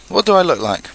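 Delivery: high call from up above; shouted down. A narrator's reading. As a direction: call it down to someone below. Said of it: "shouted Winnie the Pooh down".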